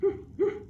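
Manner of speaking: high, loud loving barks; sleepy dog says I love you; happy woofs. Acted. Dog's love reply.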